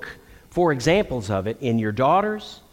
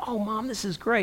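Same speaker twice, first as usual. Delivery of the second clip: delighted thanks; 8 semitones higher, 5 dB quieter than usual. Retold or imitated. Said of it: "she'd say".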